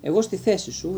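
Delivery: plain reading voice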